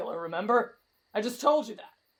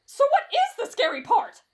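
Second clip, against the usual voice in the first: high-pitched nervous demand; alarmed; 8 semitones higher, 4 dB louder than usual. Acted. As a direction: ask it in mounting alarm. Acted fright at camping ghost tale.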